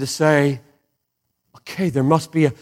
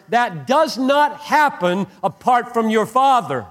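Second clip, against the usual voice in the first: higher, driving sermon build; full-force preaching; 7 semitones higher than usual. Acted. Pastor driving home God's care over a sparrow, fervent preaching. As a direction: preach it, rising with fervor.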